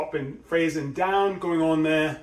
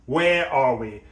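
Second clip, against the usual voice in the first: usual speaking voice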